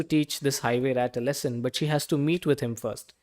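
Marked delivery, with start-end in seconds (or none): none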